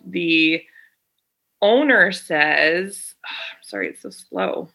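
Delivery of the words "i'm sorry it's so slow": muttered aside. Real, not acted. Apologizing while the page loads.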